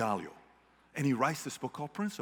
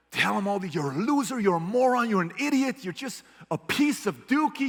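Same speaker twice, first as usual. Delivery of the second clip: rising, louder mock berating; mock insults; 7 semitones higher, 4 dB louder than usual. Boss berating employee as an example, mock yell. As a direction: ham up a boss yelling insults. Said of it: "I'm not saying".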